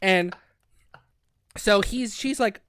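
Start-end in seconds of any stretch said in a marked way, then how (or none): none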